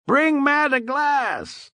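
slow, high shouted order; bawled order. A narrator's reading. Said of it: "he bawled".

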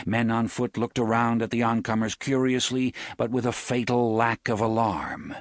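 ordinary delivery, nothing marked

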